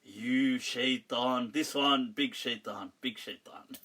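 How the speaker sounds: low drawn-out accusation sinking away softly; mock hate message. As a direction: imitate a ponderous accuser, dragging the insult. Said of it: "You read the usual".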